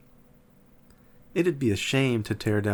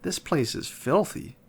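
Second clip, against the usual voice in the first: snapped; 6 semitones higher than usual. A narrator's reading. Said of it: "Melody snapped".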